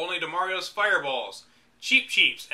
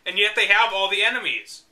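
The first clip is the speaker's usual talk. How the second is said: usual speaking voice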